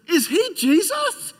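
put-on high, slow, wide-eyed child voice; a toddler asking out loud; loud child's question.

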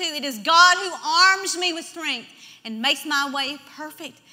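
loud, slow, weighty verse; emphatic scripture proclamation. Acted. Preacher quoting a Psalm to the congregation, emphatic. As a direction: proclaim it with conviction.

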